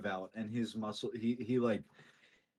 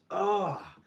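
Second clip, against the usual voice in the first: high, loud "ah" of exhaustion; strained groan; 10 semitones higher, 5 dB louder than usual. Acted. Fisherman collapsing as his arms give out, strained groan. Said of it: "his arms just give out".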